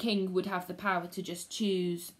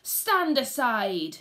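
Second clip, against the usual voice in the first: slow, loud command; demanding; 3 semitones higher, 6 dB louder, much slower than usual. Acted. Macbeth ordering the witches aside, loud command. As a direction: order them out of the way. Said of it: "he demanded of the witches".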